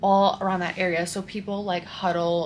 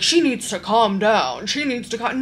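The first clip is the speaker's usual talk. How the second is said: louder, mock-exasperated imitation; mimicked guard complaint; 3 semitones higher, 7 dB louder than usual. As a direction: mimic an officious guard, pushing up the volume. Mimics guard telling her to calm down.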